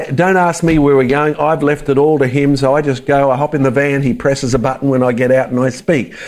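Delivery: ordinary talk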